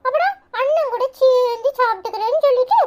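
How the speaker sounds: put-on high-pitched little kid brother voice; little brother, eager. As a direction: chatter up high like a small boy telling his big brother. (உச்ச குரலில் பேசும் குட்டித் தம்பி)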